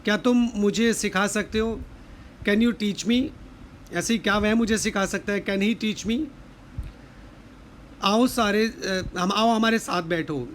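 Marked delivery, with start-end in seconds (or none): none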